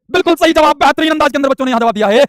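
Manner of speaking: high, loud, delighted announcement; excited praise. Real, not acted. Teacher praising students for correct answers, excited call. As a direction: cheer the class on at full pitch.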